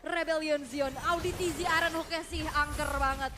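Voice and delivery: high, loud fast play-by-play; hype casting. Real, not acted. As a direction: ride the teamfight swing loudly. Caster calls a fight swing. (komentator heboh)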